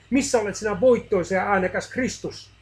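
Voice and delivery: a desperate shout to an absent Christ; anguished cry. A narrator's reading. Man cries out to silent Christ, anguished loud shout. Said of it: "Hän parkaisi hädissään".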